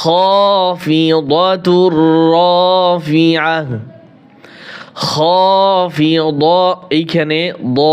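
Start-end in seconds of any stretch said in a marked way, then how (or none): 0.0-6.6 slow, drawn-out tajweed chant; Quran recitation. Shown as a demonstration. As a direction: recite each word with long held vowels. Recites the verses. (ধীরে টেনে টেনে কোরআন তিলাওয়াত)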